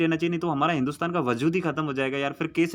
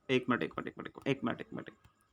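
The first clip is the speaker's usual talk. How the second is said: fading to a whisper; 5 semitones lower, 11 dB quieter than usual. Real, not acted. Teacher pauses, drops his voice.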